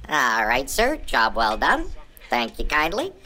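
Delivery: low, soft courtly voice; deep gentleman voice. Acted. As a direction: thank him like a dignified old gent.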